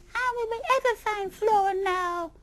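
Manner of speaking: very high, loud despairing wail; wailing mother rabbit. Acted. Mother rabbit despairing in the rain, wailing. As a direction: wail for the lost baby. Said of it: "wailed Missus Rabbit Bun".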